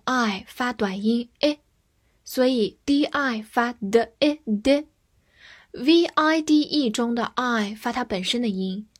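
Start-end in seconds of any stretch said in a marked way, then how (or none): none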